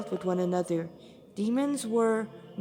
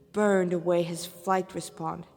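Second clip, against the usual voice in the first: ordinary delivery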